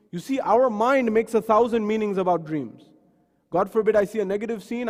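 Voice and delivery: normal talking voice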